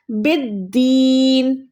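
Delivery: बिद्दीन with a held double d and very long ee; slow chanted बिद्दीन; doubled daal. Shown as a demonstration. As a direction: recite बिद्दीन slowly, leaning on the d, stretching "deeen". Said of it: "उस हरूफ को हम दो बार पढ़ते".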